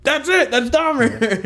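high delighted exclaim; excited high realization. Retold or imitated. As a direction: squeal it with delight. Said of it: "I was like".

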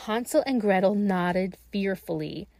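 ordinary delivery

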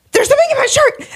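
very high squeal of alarm; retold panic. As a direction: squeal it as if the bug bites.

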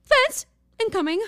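rising to high alarmed squeal; urgent hushed-then-shrill alert; panicked warning. Retold or imitated. Friends warning Spencer, rising panic.

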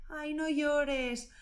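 high, slow coaxing "Ay, no llores"; soothing "no llores". Retold or imitated. Someone comforting a person who cries, coaxing. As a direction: coo it like comforting a crying child. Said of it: "lo que te sale es".

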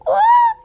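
very high, loud delighted squeak; a short sharp happy shriek; excited squeal. Shown as a demonstration. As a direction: squeal with glee. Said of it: "You get this little".

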